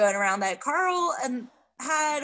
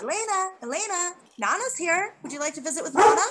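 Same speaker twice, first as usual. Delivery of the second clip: higher, coaxing sweet voice; Carl coaxing corpse; 5 semitones higher, faster than usual. Retold or imitated. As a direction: coo at her like she's alive.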